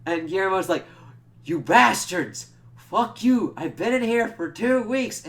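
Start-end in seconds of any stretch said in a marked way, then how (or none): 1.4-5.3 loud, high mock-furious yelling; retold angry outburst. Guillermo to those who crated him two weeks, mock-furious yell. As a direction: yell it as the wronged man, acted out.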